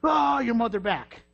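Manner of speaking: high, slow, taunting retort; hammed-up snapping back; mock comeback. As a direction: throw it back like a petty driver. Mocking retort in traffic.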